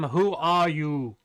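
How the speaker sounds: slow, lightly voiced barbarian hero; a hint of Arnold's Conan, drawn out. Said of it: "he asked his little running buddy one time".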